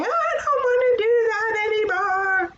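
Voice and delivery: high whiny refusal, lightly played; a touch of a grumpy kid; whiny child. Teacher voicing a child's whine. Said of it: "not yelling and saying".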